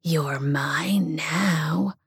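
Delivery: very slow demon whisper; a demon whispering its claim; croaking demon. Acted. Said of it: "it croaked".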